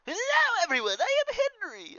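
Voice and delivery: very high, loud sing-song hello; loud cheerful greeting. Acted. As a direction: burst in and greet the whole room at the top of your voice. Greeting the whole diner as Henry.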